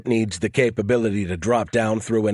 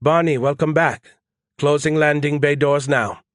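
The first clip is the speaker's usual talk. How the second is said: mild flight-controller readback; Desai on comms; 5 semitones higher than usual. Acted. Desai welcoming the pilot back, light comms voice. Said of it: "Desai replied".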